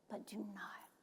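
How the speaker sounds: soft whispered lead-in. Acted. Preacher quoting Jesus's comfort, whisper. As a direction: hush before the command.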